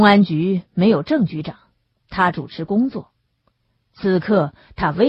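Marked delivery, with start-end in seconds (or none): none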